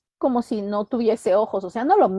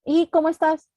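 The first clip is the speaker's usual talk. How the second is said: high-pitched casual greeting; chirpy small talk; 7 semitones higher than usual. Acted. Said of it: "La típica de".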